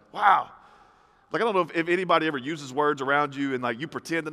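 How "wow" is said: bigger, more emphatic wow; mock amazement. Listener reacting to a confident answer, mock amazement. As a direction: punch it as an exaggerated impressed reaction.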